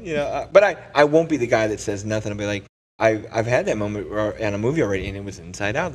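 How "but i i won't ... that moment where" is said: ordinary talk, nothing marked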